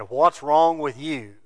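slow, cold mock snap; a touch of a gruff husband, slowed right down; callous husband. To his crying wife, a mild mock cold husband. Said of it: "callous, insensitive".

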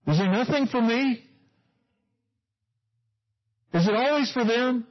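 high, aggrieved self-pitying whine; bitter whining. Retold or imitated. Preacher mimicking a bitter person's complaint, high whine. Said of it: "This is kinda how bitter people think".

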